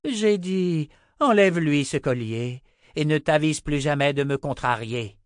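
loud, high, threatening order; angry command. Acted. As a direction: insist with open menace. Repeated order under threat.

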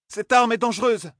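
high alarmed cry; exclaiming. A narrator's reading. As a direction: cry it out in revulsion. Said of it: "s'écria Selene".

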